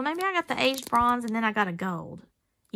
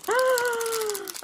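a sharp high gasp of delight; excited gasp; 12 semitones higher than usual. Real, not acted. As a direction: catch your breath at the surprise. Spots the ladybug connector.

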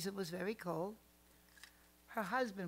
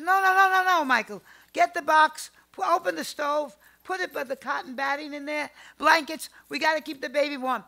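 loud, high, commanding shouts; urgent orders; 6 semitones higher, 13 dB louder than usual. Retold or imitated. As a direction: bark out orders to save the baby. Grandmother urgently orders husband.

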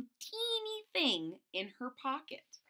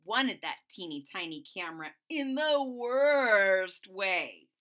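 usual speaking voice

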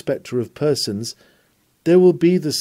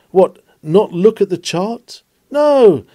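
indignant exclamation; 7 semitones higher, 5 dB louder, slower than usual. Acted. Mock dialogue on the reckless captain.

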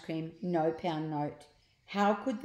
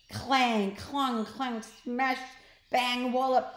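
crash sounds; 5 semitones higher, 4 dB louder, slower than usual. A narrator's reading. Crash noises read aloud.

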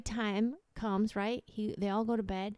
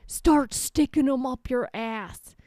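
loud, slow, acted command; stern farmer order; 3 semitones higher, 8 dB louder, slower than usual. Farmer punishes the first guy, stern and loud.

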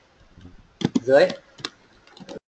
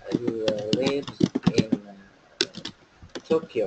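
"He lives in" broken off, pause, then "Tokyo"; halting chunks, separating "lives in" from "Tokyo"; split up for ease; 4 semitones lower, 5 dB quieter, much slower than usual. Shown as a demonstration. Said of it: "tách ra cho dễ này".

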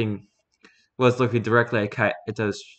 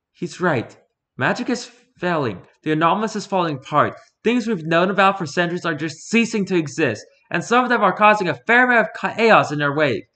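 put-on higher-pitched young woman, matter-of-fact; confident woman hunter, voiced high; Fumiko's voice; 8 semitones higher, 4 dB louder than usual. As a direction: lift the pitch up for the huntress.

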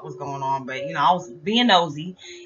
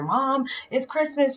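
put-on high, drawn-out kid's wake-up plea; excited child calling to mom, high-pitched; 9 semitones higher, slower than usual. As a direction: imitate kids eager on Christmas morning. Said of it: "kids get excited".

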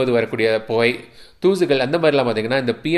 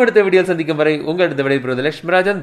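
muffled behind mask; talking through face mask; 3 semitones higher than usual. Real, not acted. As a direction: mouth covered by mask.

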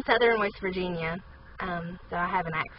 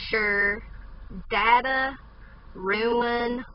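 ordinary delivery

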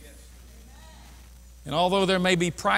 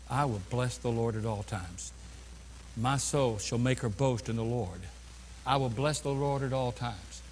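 soft recitation; 7 semitones lower, 8 dB quieter than usual. Acted. Quoting psalm softly.

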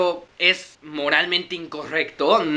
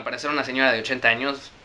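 plain reading voice

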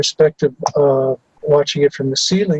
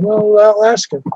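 higher, slowed, mock-kindly ritual voice; retold figure's voice; 7 semitones higher, 3 dB louder than usual. The man offering to ask the boy, in a high, slow put-on voice. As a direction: lightly voice the man, drawn out and high.

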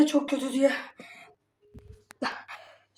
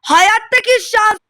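despairing wail; 4 semitones higher, 8 dB louder, slower than usual. Real, not acted. Despairing at bad luck.

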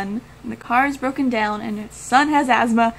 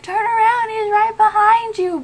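high, louder mock warning shout; urgent warning; 10 semitones higher, 5 dB louder than usual. Viewer warning the heroine on screen, urgent high shout. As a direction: call out like a panicked viewer. Acting out movie warning cliché.

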